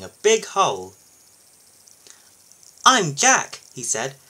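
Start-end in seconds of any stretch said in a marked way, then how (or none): 2.8-3.6 high, loud, keen little loader; perky high character voice offering help; eager newcomer. A narrator's reading. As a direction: introduce yourself eagerly, pitched way up.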